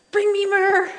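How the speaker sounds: high, loud mock command; playful demand. Real, not acted. Speaker begging the group for myrrh, loud playful demand. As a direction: call out for it like a queen. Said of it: "playful demand to audience".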